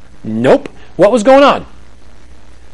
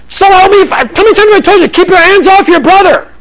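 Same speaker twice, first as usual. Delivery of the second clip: much higher, louder, fast furious yell; dad blowing up; 14 semitones higher, 5 dB louder than usual. Retold or imitated. Dad losing it at his son over a small thing, furious yell. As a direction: explode at the kid, zero to sixty. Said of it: "Dad is blowing up".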